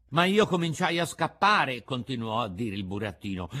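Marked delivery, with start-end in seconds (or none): 0.1-1.8 high, loud puppet outburst; alarmed puppet. A narrator's reading. Puppet tells companions of assassins, high alarm. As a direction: announce it loudly as the puppet. Puppet continues his tale.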